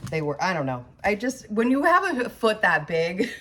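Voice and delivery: ordinary delivery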